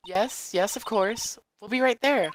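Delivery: put-on high girl voice. Maya answers the office phone, high then shocked.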